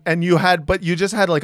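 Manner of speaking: ordinary delivery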